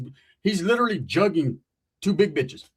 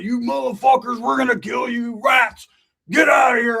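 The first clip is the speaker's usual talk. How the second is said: higher, louder yelled threats; angry shout; 7 semitones higher, 6 dB louder than usual. Acted. Roach yelling at them to leave. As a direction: bellow them off. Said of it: "Roach is like".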